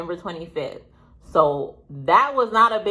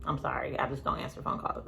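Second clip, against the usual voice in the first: quiet aside; 5 semitones lower, 13 dB quieter, faster than usual. Real, not acted. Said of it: "I'm just one of those people".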